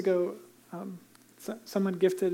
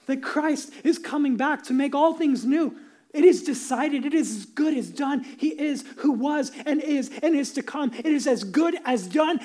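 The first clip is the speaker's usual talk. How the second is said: raised, ringing sermon proclamation; preaching; 6 semitones higher, 4 dB louder than usual. Acted. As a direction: declare the victory with full force. Triumphant proclamation.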